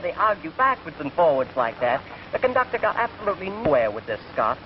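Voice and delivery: ordinary talk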